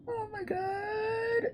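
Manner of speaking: very high, tearful whine; tearful squeak. Real, not acted. Streamer overwhelmed by a viewer's support, high tearful squeak. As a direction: squeeze it out high as if crying.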